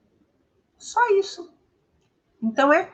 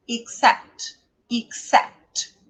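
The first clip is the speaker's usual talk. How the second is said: "Except" stressed on the second syllable, cept; brief first syllable, firm "sept" in "Except"; teacher modelling "Except" with weight at the end; stress demo; much slower than usual. Shown as a demonstration. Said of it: "eu já sei que a sílaba tônica é essa".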